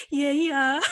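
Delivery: high, loud, laughing yeah; mock-smug childish glee; gleeful boast. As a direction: crow it proudly, breaking into laughter. Kid proud of her cool mom.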